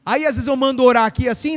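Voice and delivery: usual speaking voice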